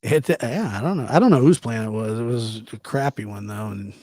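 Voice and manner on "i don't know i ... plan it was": ordinary delivery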